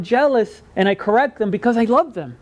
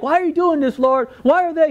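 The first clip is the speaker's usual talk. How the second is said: higher-pitched pleading questions to God; mock protest; 5 semitones higher than usual. Acted protest to the Lord.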